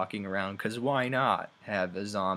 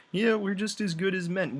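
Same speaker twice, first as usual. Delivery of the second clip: higher sing-song mock boast; mocking parody; 9 semitones higher, 3 dB louder than usual. Mocking how games make women boast, sarcastic parody. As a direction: parody it with an eye-roll in the voice. Mocking female game characters.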